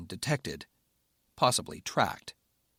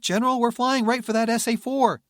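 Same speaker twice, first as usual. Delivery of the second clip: shouting; 12 semitones higher, 7 dB louder than usual. Acted. Pilot shouting in combat.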